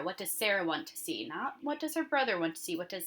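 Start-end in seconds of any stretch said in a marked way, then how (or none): 0.0-1.3 Sarah leaned on a little, falling; stress on Sarah. Shown as a demonstration. Teacher stressing Sarah in the question for her class. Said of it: "Guys, it's Sarah". 1.3-2.8 her lifted and pressed a little; stress on her. Shown as a demonstration. Teacher contrasting her brother with Sarah for the class. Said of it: "Guys, it's Sarah".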